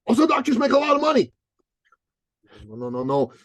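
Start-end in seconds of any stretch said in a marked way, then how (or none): 0.1-1.3 put-on high, naive young guy; eager trainer voice. Dan telling his coach about doctors, high naive voice. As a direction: voice him high and earnest. Dan adding money reason.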